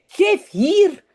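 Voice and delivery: snatch it back, cross; angry. Acted. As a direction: voorgelezen boos: Geef hier. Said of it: "zegt Jip kwaad".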